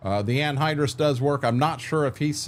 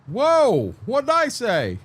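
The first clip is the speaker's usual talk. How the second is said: voicing a chat friend; 11 semitones higher, slower than usual. Streamer voices chatter Dazzle reacting, high mock surprise. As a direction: squeak it like a startled friend. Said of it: "Dazzle's like".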